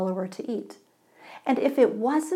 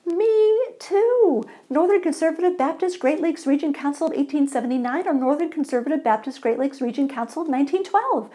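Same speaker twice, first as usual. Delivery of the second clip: thrilled, rattling off; 7 semitones higher, 3 dB louder than usual. Acted. Overjoyed man, rapid questions.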